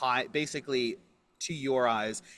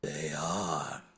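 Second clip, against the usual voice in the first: low, drawn-out spectral voice; deep, haunting confirmation; solemn ghost; 7 semitones lower, 4 dB quieter than usual. Acted. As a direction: intone it slowly like an apparition.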